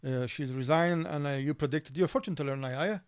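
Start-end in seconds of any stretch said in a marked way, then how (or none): none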